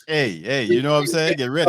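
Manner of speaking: hyped-up call. Real, not acted. Host pumping up the show.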